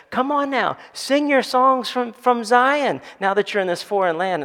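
a hint of taunting captors; mocking captors. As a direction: sneer it like a captor demanding a song. Captors mocking exiles to sing.

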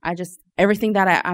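ordinary delivery

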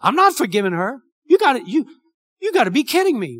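high, loud, outraged disbelief; a spouse refusing to forgive, loud and incredulous; indignant protest. Retold or imitated. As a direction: scoff at the very idea, loudly. Voicing an unforgiving spouse's protest.

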